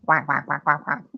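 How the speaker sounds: lightly imitated duck quacks; duck quacking. Host copying the video's duck talk, playful imitation quacks. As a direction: quack playfully, mimicking someone speaking duck. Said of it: "you can definitely speak duck".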